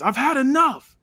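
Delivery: high, loud yell in his face; acted yell. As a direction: scream it right at him. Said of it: "starts yelling in his face".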